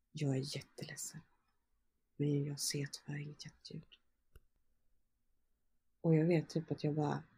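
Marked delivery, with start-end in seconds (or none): 0.1-3.8 soft whisper, almost no voice; whispered bad news. Midwife holding her, whispering the baby has no heartbeat. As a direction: breathe the words gently, like breaking terrible news.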